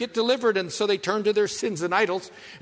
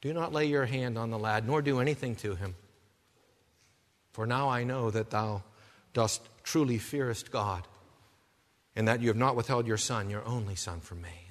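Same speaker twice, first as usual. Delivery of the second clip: gentle divine command; 9 semitones lower, 7 dB quieter than usual. Retold or imitated. Angel's words, soft after call.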